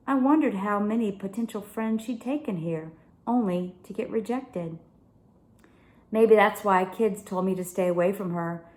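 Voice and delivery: ordinary delivery, nothing marked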